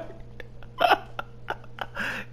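breaking down laughing; helpless laughter. Real, not acted. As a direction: laugh until the words stop. Cracking up at own story.

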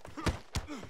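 high quick laugh that drops away; short high cackle; burst of laughter. Real, not acted. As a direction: laugh out at the screen. Laughing at the game.

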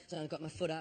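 normal talking voice

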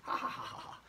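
soft breathy ha ha; light laugh. Shown as a demonstration. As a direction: laugh it out softly. Demonstrating laughter sound.

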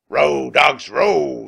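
loud pirate bellow; shouted order. Acted. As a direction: bellow at the rowers. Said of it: "said Captain Bones".

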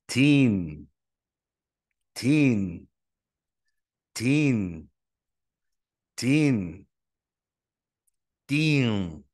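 put-on teen said crisply with a clear long ee; model the ending teen. Shown as a demonstration. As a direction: repeat teen with an aspirated t and a long ee. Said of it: "Pay attention to how these numbers end".

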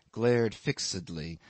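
ordinary delivery, nothing marked

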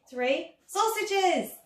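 "sausages" stretched slow and drawn out; drawn-out magic word. Acted. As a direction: call out "sausages" slowly. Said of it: "the magic word".

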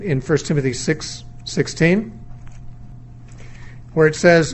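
ordinary delivery

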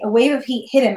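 plain reading voice

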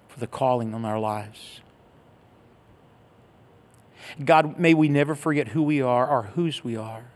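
closing prayer. Real, not acted. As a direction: pray earnestly and gently over them.

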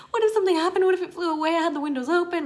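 high, loud panicky worry; panicked. Retold or imitated. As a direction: replay the panic. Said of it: "I was just in panic mode".

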